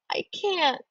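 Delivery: a lightly voiced sob, pitch breaking high; a touch of tears cracking the words upward; sobbing. A narrator's reading. Esch in the ditch refusing her brother, a mild sob. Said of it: "I sob".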